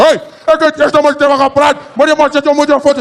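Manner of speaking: high, loud protest in Afrikaans; angry Afrikaans fan. Acted. First fan grabbing him from rival, high Afrikaans protest. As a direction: argue possessively in Afrikaans. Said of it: "This guy grabbed me".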